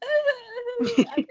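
sudden squeaky laugh; high burst of laughter. Real, not acted. Laughing at herself.

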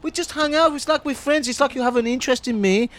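high squeaky little creature; high vermin voice. Acted. Vermin puppet character.

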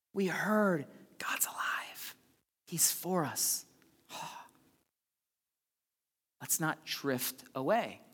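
soft, slow, whispered; hushed whisper. Acted. Pastor whispering the Easter message to the church. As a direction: lean in and whisper it. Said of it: "whispered intimate moment".

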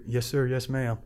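soft imitation of a voice down the hall; small, polite imitated reply; overheard politeness. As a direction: say it quietly, as if overheard. Overheard inmate's distant polite reply.

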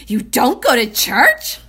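loud, slow, high-pitched mock alarm; worried exclamation. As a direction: exclaim it as an imitated worried, disbelieving question. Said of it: "in a very worried way".

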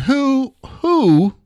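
high hooting who, who; high owl-like who, drawn out. Real, not acted. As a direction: hoot it like an owl. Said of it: "I sound like an owl now".

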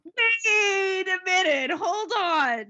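hammed-up disbelief; playful mock alarm; mock panic. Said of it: "my reaction was".

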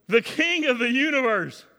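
high, loud, shouted preaching that builds; a pastor shouting to the congregation at the climax; full-force preaching. Acted. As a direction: preach it with everything you have. Preaching resumes.

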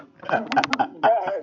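loud high burst of laughter. Real, not acted. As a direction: cackle out loud.

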